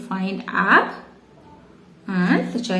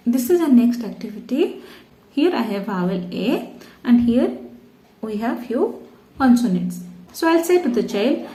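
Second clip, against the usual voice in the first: ordinary talk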